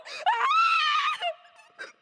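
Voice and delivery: piercing terrified wail; anguished high scream. Acted. Girl screaming in terror during the attack.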